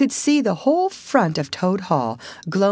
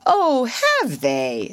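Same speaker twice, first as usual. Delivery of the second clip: slow, higher, rising to the challenge; mild indignation; 5 semitones higher, much slower than usual. Acted. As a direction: drag it out as a riled challenge. Toad riled, seizes a stick.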